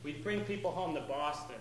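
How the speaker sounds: ordinary delivery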